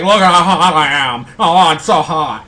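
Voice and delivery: loud, hammed-up self-admiration; imitating female vlogger. Host mocks Julia dancing around in her videos, loud hammed-up boasting. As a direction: crow it loudly, slowing into a mock swoon. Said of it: "and she's like".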